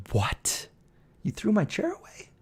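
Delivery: hushed "what", then high incredulous question; retold disbelief. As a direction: act out stunned disbelief, quietly rising. Retold reaction to chair thrown out.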